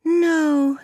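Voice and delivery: put-on high child voice, a touch of sadness; high-pitched, mildly sad child; sad little girl. A narrator's reading. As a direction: answer as a downcast small girl. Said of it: "said the child sadly".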